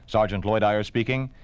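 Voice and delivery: ordinary talk, nothing marked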